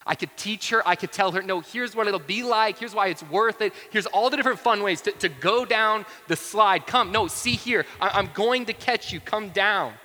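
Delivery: high, loud, building insistence; urgent pleading. Retold or imitated. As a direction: preach it as a parent desperately coaxing a child. Preacher acts out urging his child.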